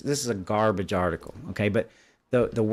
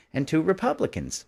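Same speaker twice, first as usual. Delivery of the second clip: "Republicans" lifted much higher in pitch, leaned on hard; stress on Republicans; 8 semitones higher than usual. Shown as a demonstration. Reading the article aloud to viewers, stressing "Republicans" pointedly. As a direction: hit the word "Republicans" so viewers can't miss it. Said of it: "which I stress the word Republicans there".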